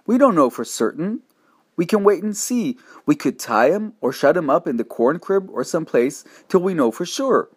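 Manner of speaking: high, urgent begging; pleading boy grasping at hope; desperate pleading. Acted. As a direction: beg frantically to wait and see. Boy pleading for his dog.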